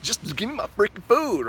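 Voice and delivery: mock hungry anger. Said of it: "I'm a little bit angry".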